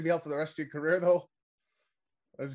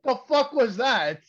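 high, loud disbelieving yell; bro, what did I just watch; shocked outburst; 10 semitones higher, 5 dB louder, slower than usual. Real, not acted. Cohost reacting to a brutal botch, shocked yell.

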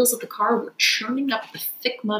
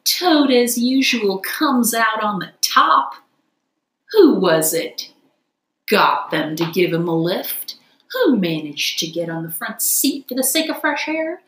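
gloating Toad; 3 semitones higher, 5 dB louder than usual. Acted. Toad gloating self-admiration.

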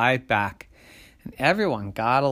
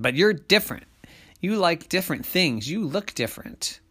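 objecting monsters; 6 semitones higher than usual. A narrator's reading.

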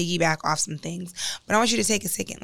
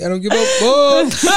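loud; 7 semitones higher, 8 dB louder than usual. Pretend. Drops the soft act loudly.

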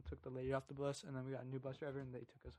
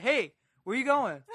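slow, louder, higher call; 6 semitones higher, 12 dB louder, slower than usual. Retold or imitated. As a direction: call out to the driver, puzzled. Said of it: "I'm like".

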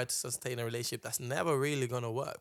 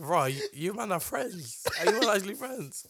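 higher, louder, disbelieving; incredulous; 7 semitones higher, 7 dB louder than usual. Retold or imitated. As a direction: ask in amused disbelief. Retold own inner reaction.

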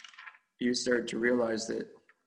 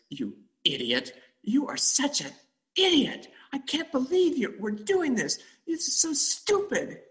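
much higher, louder, exasperated scolding; berating inner voice; 14 semitones higher, 5 dB louder than usual. Retold or imitated. Inner voice scolding the meditator, high exasperated yell. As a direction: yell at yourself like a fed-up critic. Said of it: "And the voice says".

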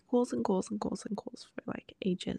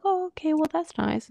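imitating mum, pitched much higher; mum's reply; 8 semitones higher, 4 dB louder than usual. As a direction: play the mother's sweet, high phone reply. Mum voiced on the phone.

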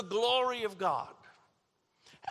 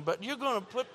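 ordinary talk, nothing marked

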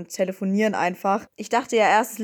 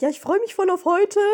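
put-on chirpy girl voice; 9 semitones higher than usual. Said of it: "die sagt".

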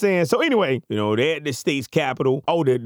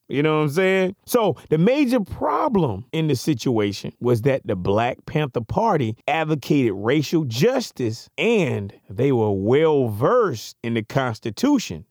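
ordinary talk, nothing marked

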